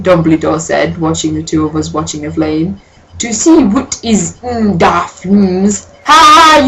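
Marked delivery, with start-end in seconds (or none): 3.2-5.9 slow, lightly hammed-up wise old wizard; mock Dumbledore. Reader voices Dumbledore. 6.0-6.7 real laugh. Real, not acted. Reader laughs at the text.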